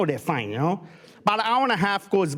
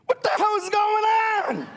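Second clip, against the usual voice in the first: mock shout together; 12 semitones higher than usual. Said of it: "look at the twins and go".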